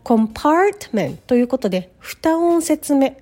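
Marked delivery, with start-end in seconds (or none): 0.0-1.2 "Compartment" with PART drawn out; stress on second syllable. Shown as a demonstration. Teacher showing learners where stress falls, second syllable. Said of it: "二音節目にストレスが置かれます".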